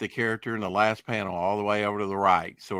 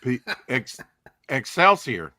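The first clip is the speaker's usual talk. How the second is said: Stan Lee; 4 semitones higher, slower than usual. Retold or imitated. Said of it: "trying to imitate Stan Lee".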